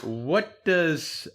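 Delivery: ordinary delivery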